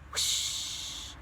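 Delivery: breathy whoosh of a spinning wheel; imitated whoosh. A narrator's reading. Narrator imitating the skate wheel, a soft blown whoosh.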